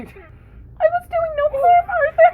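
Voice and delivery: extremely high, slow hog voice. Acted. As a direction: stretch it out at the top of the range.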